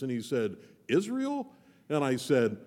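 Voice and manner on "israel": sudden high-pitched puzzled "Israel?"; surprised question. Retold or imitated. Camera guy to pastor, confused and surprised. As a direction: camera guy jumps to the wrong Nazareth.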